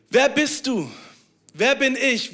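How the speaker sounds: ordinary talk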